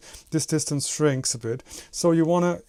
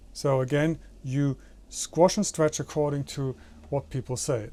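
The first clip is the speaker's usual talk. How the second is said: ordinary talk, nothing marked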